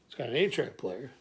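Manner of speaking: normal talking voice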